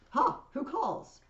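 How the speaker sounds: high, loud startled demand; acted imperious surprise; startled challenge. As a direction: react sharply to a voice in the crowd. Caesar reacts to a shout.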